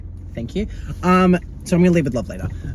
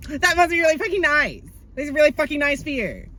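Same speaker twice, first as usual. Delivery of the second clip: much higher, biting sarcasm; sarcastic; 11 semitones higher than usual. Pretend. Jabbing at the unstressed classmate with high sarcasm. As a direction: sneer it sweetly.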